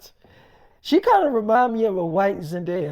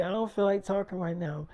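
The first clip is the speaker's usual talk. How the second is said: quiet retold withdrawal; 2 semitones lower, 7 dB quieter than usual. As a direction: play the girlfriend shutting down, softly. Girlfriend withdrawing in retold quarrel.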